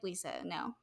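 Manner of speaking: low, quiet mock reproof; disapproving refusal. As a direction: shut it down softly and low. Others shut down her innuendo.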